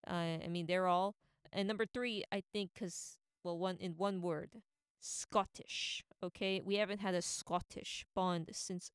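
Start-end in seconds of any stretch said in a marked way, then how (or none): none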